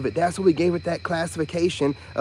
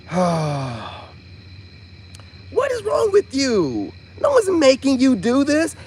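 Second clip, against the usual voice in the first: high, drawn-out mock exasperation; mock frustrated reader; 8 semitones higher, slower than usual. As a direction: mimic a fed-up reader, pitched high. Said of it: "they'll seem so frustrated".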